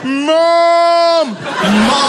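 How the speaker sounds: high, loud cry for mum; panicked child's cry of Mum; shout for mum. Retold or imitated. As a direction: yell for your mother in panic. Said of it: "I shouted for my mother".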